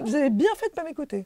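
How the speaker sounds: lightly voiced high old lady, laughing; a hint of the teacher conceding; imitating Mireille. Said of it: "elle m'a dit".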